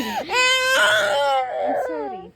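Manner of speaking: slow, drawn-out sobbing "I'm sorry"; sobbing apology. Real, not acted. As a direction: stretch the apology through sobs. Apology amid crying. (humihikbing paumanhin)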